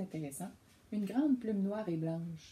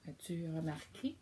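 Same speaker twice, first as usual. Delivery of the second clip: plain reading voice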